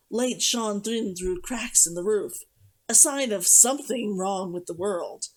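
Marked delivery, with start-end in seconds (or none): none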